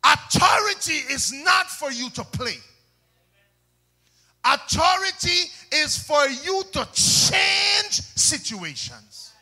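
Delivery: high, loud pulpit shout; preaching at full force. Real, not acted. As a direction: proclaim it to the whole church at full volume.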